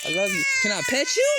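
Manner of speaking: very high cooing voice. Real, not acted. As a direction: coax the cat sweetly. Baby talk to cat.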